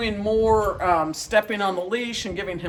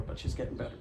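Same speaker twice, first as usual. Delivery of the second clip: whispered aside; 8 semitones lower, 10 dB quieter, faster than usual. Real, not acted. Aside, fading.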